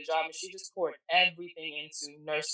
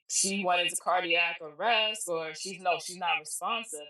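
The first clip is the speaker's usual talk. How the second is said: normal talking voice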